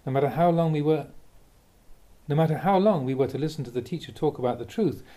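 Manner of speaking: normal talking voice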